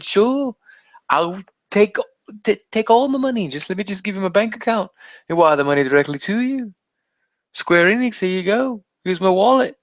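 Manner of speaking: high-pitched, gushing fan; hammed-up eagerness. Fan offering Square Enix everything, eager high voice. Acts out throwing money at developer.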